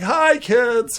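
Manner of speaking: loud, high, too-cheery hello; sudden high burst of forced cheer; overeager greeting. Acted. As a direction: greet them loudly to cover up. Caught hiding books, greeting the kids.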